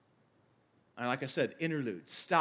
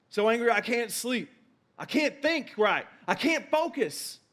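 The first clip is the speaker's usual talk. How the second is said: acted exasperated anger; 5 semitones higher than usual. Preacher voicing someone too angry to sleep, mock exasperated. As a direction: act worked up with anger, pitched high. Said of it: "How many of you have ever felt".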